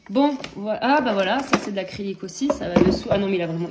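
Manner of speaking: ordinary delivery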